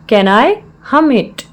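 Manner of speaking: slow, word-by-word teaching delivery; slow repeat. Shown as a demonstration. Teacher repeats the English phrase for learners, slowly.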